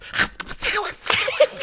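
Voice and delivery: angry cartoon duck squawk; Donald Duck angry noise. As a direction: sputter like a furious cartoon duck. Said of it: "Can you do a Donald Duck impression?".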